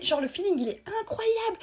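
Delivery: high squeal of delight; gushing. Acted. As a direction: swoon high over your new crush. Gushing about a new crush. (s'extasier d'une voix aiguë)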